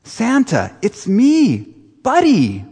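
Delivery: high, eager Buddy voice; Buddy the elf. Retold or imitated. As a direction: play a wide-eyed grown-up elf. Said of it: "Here's Will Ferrell".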